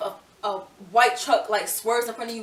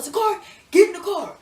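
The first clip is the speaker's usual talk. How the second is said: imitating dad; 10 semitones higher, 7 dB louder than usual. Dad orders her into car.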